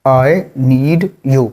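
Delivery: dragged out slowly. Shown as a demonstration. Teacher drills the phrase very slowly. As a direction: stretch every word. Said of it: "मुझे तुम्हारी ज़रूरत है".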